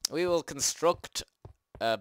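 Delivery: slow, lightly pompous proclamation; mock grand announcement. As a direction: announce it like a hammy herald. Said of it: "grand announcement then joke".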